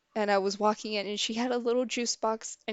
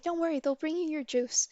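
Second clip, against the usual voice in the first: higher-pitched child imitation; little sister; 7 semitones higher than usual. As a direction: reassure like a small kid. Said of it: "And she's like".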